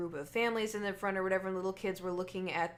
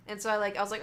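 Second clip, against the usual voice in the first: ordinary talk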